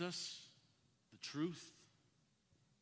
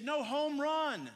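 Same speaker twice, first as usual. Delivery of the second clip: put-on slow, high, loud childish insisting; stubborn shout; 10 semitones higher, 8 dB louder, much slower than usual. Son defying his dad, slow high shout. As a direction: drag it out like a defiant kid.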